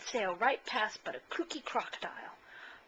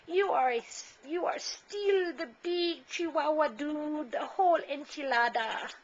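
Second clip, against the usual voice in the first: higher, slow hammed-up Spanish accent; bad Spanish accent; 7 semitones higher, 4 dB louder than usual. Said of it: "using his very best Spanish accent".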